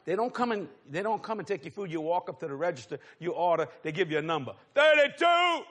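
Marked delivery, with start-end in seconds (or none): 4.7-5.6 loud, high, drawn-out shout; calling out numbers. Acted. Counter worker shouting order numbers to waiting customers, loud call. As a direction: holler it over a crowded room.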